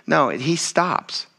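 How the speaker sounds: usual speaking voice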